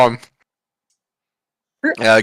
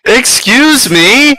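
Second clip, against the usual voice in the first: high, drawn-out disbelief; indignant; 12 semitones higher, 5 dB louder, much slower than usual. Real, not acted. Player objecting to a penalty for being late, indignant. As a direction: stretch it out high in affronted surprise.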